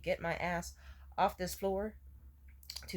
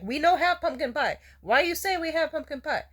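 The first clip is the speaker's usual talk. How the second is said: higher, louder mock waiter in a broken English accent; 9 semitones higher, 7 dB louder than usual. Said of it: "I know you might think that from the accent".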